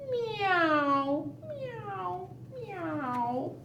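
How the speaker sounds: sad, fading kitten meows; sighing kitten meows. Acted. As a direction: mew like sorry little kittens. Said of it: "Then they began to sigh".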